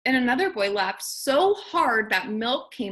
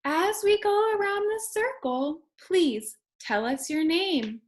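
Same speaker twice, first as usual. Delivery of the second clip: higher, sing-song teacher; lightly voiced kindergarten teacher; 7 semitones higher than usual. A narrator's reading. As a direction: invite the kids gently, like a kindergarten teacher. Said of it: "The teacher said".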